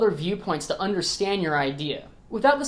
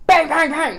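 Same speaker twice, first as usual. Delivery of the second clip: mock gunshots; 9 semitones higher, 6 dB louder than usual. Gunshots after outlaw impression.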